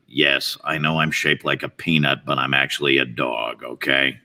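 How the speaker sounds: very low, weary dog voice; deadpan it, fed up with explaining; bored, dyspeptic dog. Acted. Dog explaining his peanut shape, bored and dyspeptic. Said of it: "looks a little more bored and dyspeptic".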